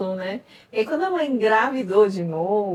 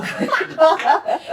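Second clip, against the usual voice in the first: embarrassed laugh; 7 semitones higher, 3 dB louder than usual. Real, not acted. Laughs at her own confession.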